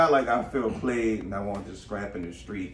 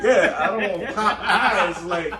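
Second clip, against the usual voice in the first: high, loud, laughing through words; can barely talk for laughing; 9 semitones higher, 7 dB louder than usual. Real, not acted. Laughing fit after joke.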